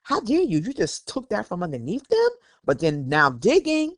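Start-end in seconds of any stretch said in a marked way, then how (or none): 0.0-2.3 high-pitched mock outrage; retold indignation. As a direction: replay your offended disbelief in a raised pitch. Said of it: "that's how I felt".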